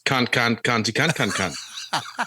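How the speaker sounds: crude mock Australian accent. Shown as a demonstration. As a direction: show off a silly Australian accent. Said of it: "if you want my Australian accent".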